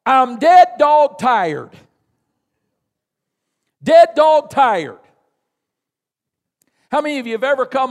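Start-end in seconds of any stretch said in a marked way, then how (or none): 0.0-1.7 slow, loud Southern drawl on dead dog tired; Southern dead dog tired, drawn out; Southern saying. Acted. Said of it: "here's how we'd say it in the South". 3.8-5.0 slow, loud Southern repeat of dead dog tired; slow Southern echo; Southern saying echoed. Acted. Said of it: "here's how we'd say it in the South".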